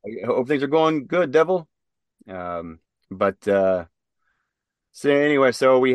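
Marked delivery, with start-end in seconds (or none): 0.0-1.7 Gunny Ortega's voice. Retold or imitated. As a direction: sign off like the old gunny. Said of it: "in his voice".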